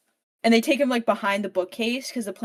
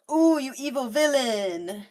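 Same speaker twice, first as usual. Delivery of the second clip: higher, sing-song jeer; mocking taunt; 5 semitones higher, 4 dB louder than usual. Brothers taunt Tristan in the arena, mocking jeer. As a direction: tease the captured villain.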